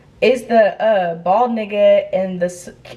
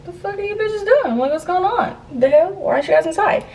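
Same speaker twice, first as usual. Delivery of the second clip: high-pitched, incredulous questioning; acting out bewildered exasperation; baffled confrontation; 10 semitones higher than usual. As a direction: demand answers, pitched well up. Confronting friends found outside.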